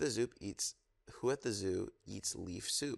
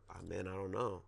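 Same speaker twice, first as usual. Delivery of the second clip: ordinary delivery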